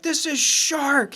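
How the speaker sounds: high, loud, slow shout; mock shout. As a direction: spell out the base name over the rotors. Shouting base name at pilot.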